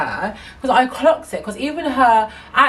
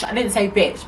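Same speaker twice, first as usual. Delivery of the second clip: usual speaking voice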